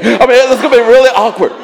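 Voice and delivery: a loud laughing aside; loud amused aside about the awkwardness; excited loud. Real, not acted.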